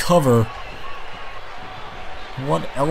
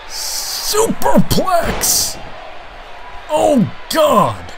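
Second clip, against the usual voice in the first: stretched, very high shouted call; excited shout; much slower than usual. Real, not acted. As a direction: drag out the move name in a big shout. Big move called live.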